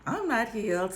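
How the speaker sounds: ordinary talk, nothing marked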